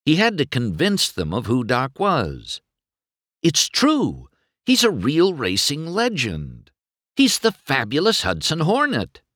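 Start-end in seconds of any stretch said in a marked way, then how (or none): 3.4-9.2 high-pitched, urgent persuading; earnest, worked-up insistence; desperate insistence. Acted. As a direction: plead to be believed. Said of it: "He felt almost desperate".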